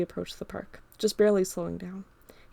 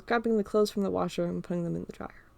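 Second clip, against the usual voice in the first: ordinary delivery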